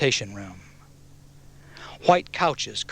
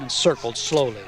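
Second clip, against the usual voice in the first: plain reading voice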